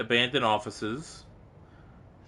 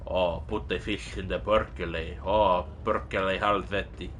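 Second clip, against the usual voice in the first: put-on Finnish accent; 7 semitones lower, 5 dB quieter than usual. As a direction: grumble it like a Finn. Finnish swearing, different voice.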